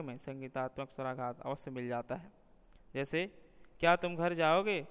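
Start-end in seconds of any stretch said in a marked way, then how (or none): 3.8-4.9 जाओगे said on a higher pitch, question rising; question with raised tune. Shown as a demonstration. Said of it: "जाओगे का उच्चारण कुछ ऊंचे स्वर में होता है".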